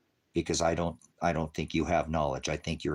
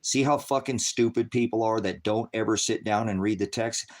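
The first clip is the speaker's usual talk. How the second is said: ordinary talk, nothing marked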